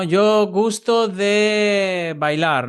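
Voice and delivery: imitating a learner. Teacher quoting a student's Portuguese-style error, slow and high.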